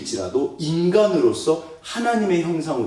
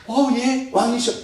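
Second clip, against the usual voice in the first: fawning courtier; 11 semitones higher than usual. Acted. Mock fawning to a king.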